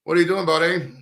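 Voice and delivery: playful put-on Canadian accent; mock Canadian accent. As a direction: greet a pal like a chummy Canadian. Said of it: "I love the way the Canadians call everybody buddy".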